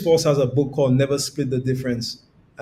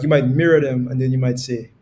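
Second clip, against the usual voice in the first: ordinary talk, nothing marked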